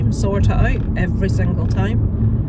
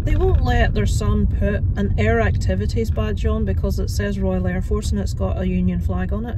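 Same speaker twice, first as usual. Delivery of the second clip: ordinary delivery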